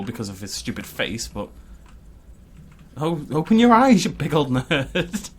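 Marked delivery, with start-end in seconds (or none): 3.0-5.3 mock exasperation. Scolding dinosaur fondly.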